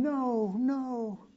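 high, pleading no from a mother; Mary pleading no. Acted. Preacher voices Mary begging Jesus at the cross, high pleading. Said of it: "But she's going".

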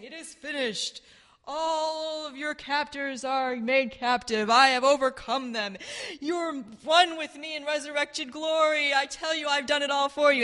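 the resurrected Christ. Retold or imitated. The risen one frees his captives, triumphant. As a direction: declare victory in a high, sustained voice. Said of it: "like the resurrected Christ, saying".

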